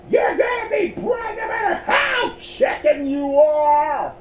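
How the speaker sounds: very high, loud, drawn-out order; bossy yell. A narrator's reading. Helena orders cowardly Miles, high screeching yell. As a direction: screech the command slowly and forcefully.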